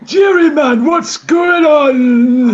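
put-on loud old Geordie dad, shouting; Geordie old father. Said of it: "It's me, your father, Geordie Geriatric".

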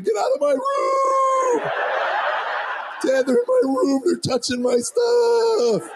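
very high, slow, squealing yell; high screaming. Retold or imitated. Son screams at his brother from the hall, squealing high. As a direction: shriek it like a boy whose voice never broke. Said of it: "Standing in the hall screaming".

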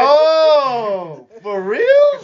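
high, loud laughing disbelief; shocked laugh. Real, not acted. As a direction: blurt it out in shock. Shocked by the package joke.

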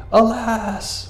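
high, drawn-out wail of alarm; freaking out. Acted. Preacher acts out Gideon seeing God's angel, freaking out. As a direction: stretch it out high in mock panic. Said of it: "when he freaks out like that".

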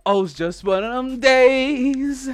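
loud, high, slow hype intro; hype announcer. Acted. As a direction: pump up the crowd.

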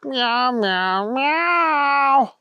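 high mewing kitten voice; cat meow. Acted. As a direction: mew it like a small cat. Cat signals the agent.

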